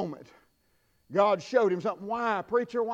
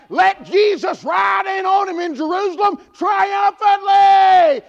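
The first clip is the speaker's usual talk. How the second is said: rising, louder and higher revival preaching, shouted at the end; full-force preaching; 8 semitones higher, 8 dB louder, slower than usual. Acted. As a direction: build the sermon into a fiery shout.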